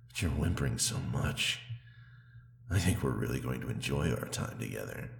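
ordinary talk, nothing marked

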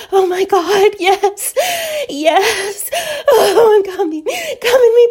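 high, loud gasping moans; acted climax. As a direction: cry out and moan, overwhelmed with pleasure. Acted climax of sex scene.

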